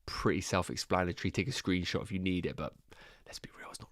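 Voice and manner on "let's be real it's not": whisper. Real, not acted. Aside to viewers about dull slide.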